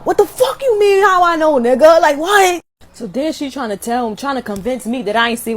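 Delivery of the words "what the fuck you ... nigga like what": high, loud incredulous yell; hammed-up outrage at being doubted; indignant outburst. Caller answers doubting mama, indignant yell.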